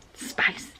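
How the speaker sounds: breathy whisper of one word. Real, not acted. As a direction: whisper it like a secret. Reading spice pack aloud.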